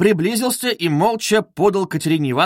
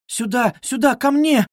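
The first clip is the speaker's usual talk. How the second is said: high, urgent plea, climbing; pleading; 9 semitones higher than usual. A narrator's reading. Sonya begging them to come to her, pleading. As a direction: implore them, voice pitched up. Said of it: "умоляла Соня".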